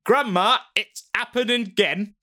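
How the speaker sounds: high, loud call to grandma; retold shout. Boy calls grandma about the poltergeist, high loud shout. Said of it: "Philip shouted".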